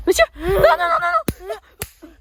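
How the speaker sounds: high frantic cries of no; panicked no. Acted. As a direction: cry out in panic. Man panics at questions.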